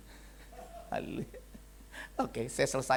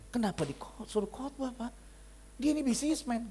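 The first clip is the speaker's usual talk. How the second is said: protesting wife; 8 semitones higher than usual. Retold or imitated. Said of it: "Istrinya telepon saya".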